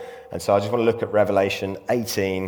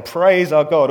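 loud, higher, ringing proclamation; loud, raised scripture proclamation; proclaiming voice from the throne; 7 semitones higher, 9 dB louder, slower than usual. A narrator's reading. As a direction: call it out as a voice from the throne. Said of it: "a voice from the throne saying".

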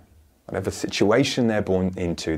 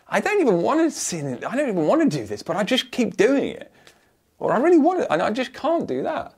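much higher, pleading and fed up; exasperated inner voice; 12 semitones higher, faster than usual. Acted. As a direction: whine it like someone stuck in a habit. Acts out frustrated inner voice.

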